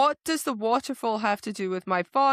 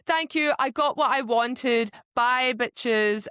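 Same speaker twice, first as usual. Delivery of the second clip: higher, louder smug goodbye; villain mocked leaving happy; smug farewell; 4 semitones higher, 4 dB louder, slower than usual. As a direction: toss it off flippantly as you leave. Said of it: "he's like".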